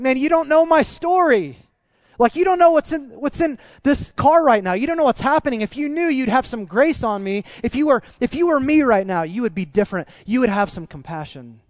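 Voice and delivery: high, loud pleading outburst; indignant protest. Acted. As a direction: protest heatedly, voice climbing high. Imagined outburst at a rude driver.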